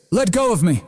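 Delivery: high-pitched indignant cry; panicked shriek. A narrator's reading. Bully caught in a crushing grip, shrieking to be released. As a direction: shriek it, voice leaping way up. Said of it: "Brody shrieked, his voice rising an octave".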